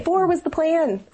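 high, slow, incredulous repeat; echoed dismay. Retold or imitated. Mother repeating her shock at a fifth pregnancy, high slow dismay. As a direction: stretch it out in disbelief.

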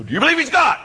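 loud, high, sudden demand; mock interrogation at high pitch; shouted challenge. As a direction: jolt the kid with a yelled question. Acted challenge to a clueless kid.

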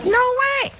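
high, loud no way; squealed loud refusal; emphatic no way. Real, not acted.

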